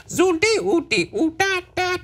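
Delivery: very high squeaky creature babble; Ewok impression. Fan mocking the cheesy forest creatures, high babbling Ewok impression. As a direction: chant nonsense like a tiny furry alien. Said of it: "It's my impression of the Ewoks".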